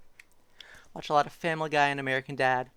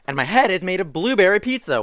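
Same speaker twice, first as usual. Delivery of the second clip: louder, higher storybook proclamation; animated story reading; 7 semitones higher, 8 dB louder than usual. A narrator's reading. Reader voices the book's monkey confession, animated read-aloud. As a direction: announce the absurd line with gusto. Reads silly book line aloud.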